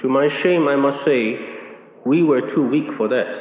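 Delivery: mock-humble, with a sly sting; sarcastic. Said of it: "he says sarcastically".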